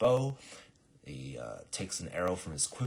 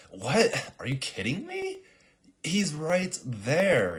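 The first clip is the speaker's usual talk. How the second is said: high, loud mock outrage; slow down hard on the last words; indignant protest; 11 semitones higher, 9 dB louder, slower than usual. Assassin pointing at the king, incredulous outburst.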